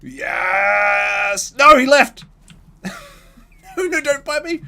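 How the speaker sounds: shrieking high-pitched scream; panicking gamer. Real, not acted. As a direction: scream as the dinosaur bites. Dinosaur attacking, screaming.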